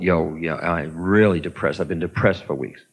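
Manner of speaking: low, heavy, glum reply; mopey depressed answer. Retold or imitated. Teacher voicing a depressed student answering him, low and glum. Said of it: "You say".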